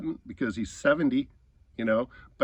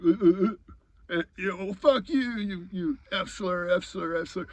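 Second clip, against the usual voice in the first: high, stretched stammering imitation of fury; sputtering mock anger; 6 semitones higher than usual. Enraged man hurling slurs back, sputtering mock anger. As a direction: mimic him sputtering insults, voice pitched up.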